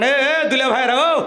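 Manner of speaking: high, insistent hailing; calling out. Real, not acted. Preacher hails men chatting in the crowd, calling out. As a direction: hail someone across the crowd.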